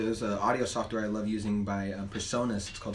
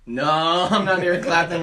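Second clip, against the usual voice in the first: louder, laughing through the no; laughing denial; 4 semitones higher, 6 dB louder than usual. Retold or imitated. Stranger mistaken for [name], laughing as he denies it. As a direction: chuckle out the denial. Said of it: "He's like".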